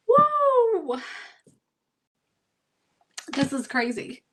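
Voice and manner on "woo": high, loud emotional whoop; excited whoop. Real, not acted. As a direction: let out an overwhelmed woo. Moved by her own reading.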